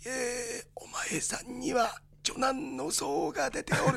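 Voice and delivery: put-on slow, quiet old fortune-teller voice; a soft, slow old man's warning; Hideji Otaki impression. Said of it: "何の時の大滝秀治さんですか".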